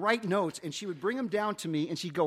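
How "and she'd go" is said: usual speaking voice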